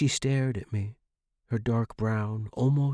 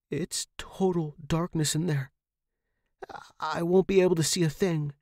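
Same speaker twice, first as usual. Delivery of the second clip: frightened protest; 7 semitones higher than usual. Acted. Tenant pushed toward dark room.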